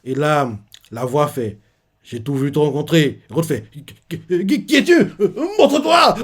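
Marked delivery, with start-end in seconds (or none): 3.7-6.1 much higher, stammering, laughing; panicky stammer. Retold or imitated. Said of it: "Rhodes commence à paniquer".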